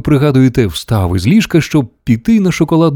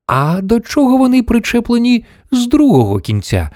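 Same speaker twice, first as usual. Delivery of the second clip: pointed question, pitched way up; challenging grandmother; 12 semitones higher than usual. A narrator's reading. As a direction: press the question in a high old-lady voice.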